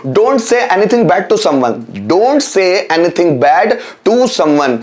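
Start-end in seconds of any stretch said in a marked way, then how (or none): 2.1-4.8 slowly, word by word; slow repeat. Shown as a demonstration. Teacher repeating an English translation for learners, slowly. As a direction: spell it out at a slow, measured pace.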